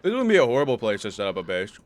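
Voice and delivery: chewing ice while talking; ice in mouth. Real, not acted. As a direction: talk around a mouthful of ice. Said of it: "Sorry, I'm chewing on ice".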